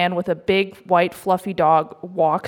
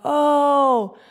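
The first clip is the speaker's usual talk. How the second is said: higher, slowed, ironic calm; deadpan sarcasm; 6 semitones higher, much slower than usual. Retold or imitated. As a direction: shrug it off like it's nothing. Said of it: "I'm like".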